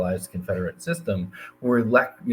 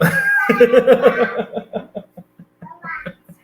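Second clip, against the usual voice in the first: embarrassed laugh; 8 dB louder than usual. Real, not acted. Embarrassed by toddler interruption.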